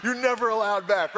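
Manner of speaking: playful mock refusal cracking up; laughing refusal. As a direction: refuse them gleefully, laughing at yourself. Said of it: "And I'm like".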